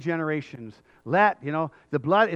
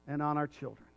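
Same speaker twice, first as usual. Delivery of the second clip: much softer, solemn drop; hushed afterthought; 2 semitones lower, 9 dB quieter than usual. Acted. Crowd cursing their own children, hushed solemn ending. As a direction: let it fall quietly after the shout.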